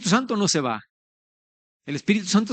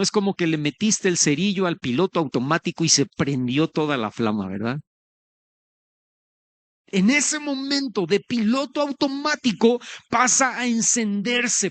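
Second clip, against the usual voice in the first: normal talking voice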